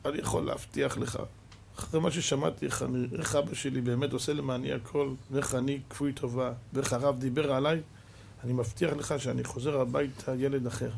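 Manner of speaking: low, hushed, remorseful promise; softly voiced contrite boy; quiet and choked-up, without sobbing; tearful boy. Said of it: "והילד בוכה".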